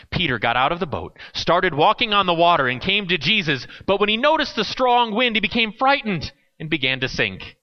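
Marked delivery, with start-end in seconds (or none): none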